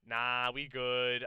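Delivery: loud, drawn-out mock brush-off; dismissive Sony. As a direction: play a company waving the offer away. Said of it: "Sony who's just kinda like".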